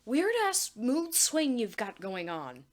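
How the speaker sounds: loud, high, slow sputtering; exasperated rant. Acted. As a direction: sputter with frustration. Anne ranting at Marcy.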